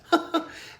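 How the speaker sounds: an amused unvoiced chuckle; a short airy laugh at the kid; light laugh. A narrator's reading. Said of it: "Charisse laughs".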